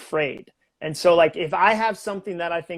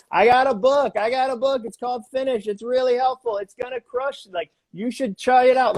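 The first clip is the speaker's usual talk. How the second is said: higher, bright plugging of his book; hyped self-promo; 7 semitones higher than usual. Retold or imitated. Speaker imitates himself plugging his book on podcasts. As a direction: sell it like an eager pitchman. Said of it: "I'm like".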